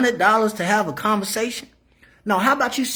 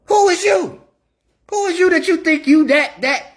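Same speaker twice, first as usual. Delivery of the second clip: indignant yell; 7 semitones higher, 4 dB louder than usual. Real, not acted. Indignant challenge to women.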